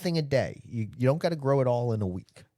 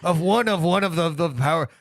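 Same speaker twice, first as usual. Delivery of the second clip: pirate-ish Irish accent; 3 semitones higher than usual. Shown as a demonstration. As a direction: ham it up like a pirate reading news. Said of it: "it's a pirate".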